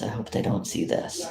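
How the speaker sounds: usual speaking voice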